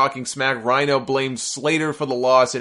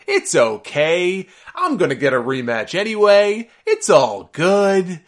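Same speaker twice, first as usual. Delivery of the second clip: mock cheerful Cena; 5 semitones higher, 4 dB louder, slower than usual. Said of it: "He would come out on TV the next night smiling".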